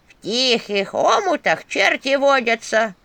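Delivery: loud, slow, high staff voice; warning. A narrator's reading. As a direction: draw out an ominous proverb in character. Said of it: "предупредила Черепуша". (предостерегающе, громко и протяжно)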